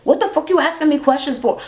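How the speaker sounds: high, sassy mock outburst; imitating belligerent rant. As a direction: throw attitude at the asker. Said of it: "she was belligerent, angry".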